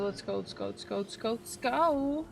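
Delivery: normal talking voice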